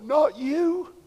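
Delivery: soft, pained disbelief; quiet plea. Pretend. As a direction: say it under your breath, heartbroken. Pained disbelief at a fallen believer.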